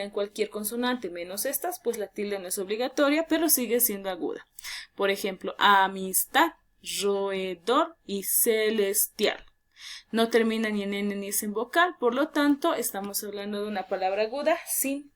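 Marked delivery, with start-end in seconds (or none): 5.6-9.4 slow classroom sounding-out; syllable by syllable. Shown as a demonstration. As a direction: slowly split words, syllable after syllable. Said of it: "si yo separo en sílabas".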